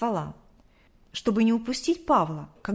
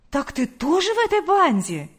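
high, loud astonished question; shocked exclamation; 7 semitones higher, 5 dB louder than usual. A narrator's reading. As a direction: cry out in disbelief, pitch leaping up. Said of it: "воскликнула Лидия Гавриловна".

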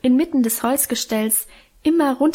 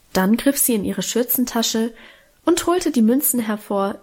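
usual speaking voice

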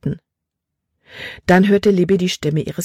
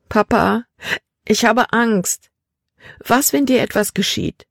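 put-on higher, frightened girl's voice; tearful fear; 6 semitones higher than usual. Daughter afraid for her father, high fearful plea. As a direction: plead anxiously, close to tears. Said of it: "Tränen stiegen Libby in die Augen".

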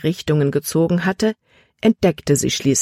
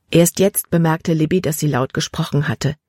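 usual speaking voice

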